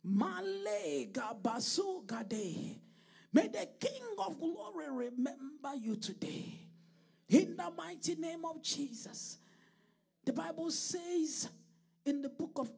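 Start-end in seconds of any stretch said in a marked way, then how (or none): 0.0-9.4 loud, forceful blessing over the congregation; fervent preached prayer. Real, not acted.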